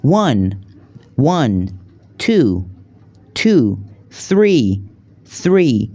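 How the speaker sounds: each English number said twice, slow and clear; number drill. Shown as a demonstration. Teacher modelling each number twice for students. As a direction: model the numbers for learners to repeat. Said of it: "بریم از یک تا ده رو به ترتیب یاد بگیریم".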